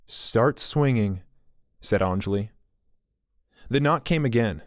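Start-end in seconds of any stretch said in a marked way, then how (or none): 0.1-1.2 slow, flat-voiced echo; deadpan repeat. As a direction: drag the words out, mocking him. Anjali deadpan repeating Bartek.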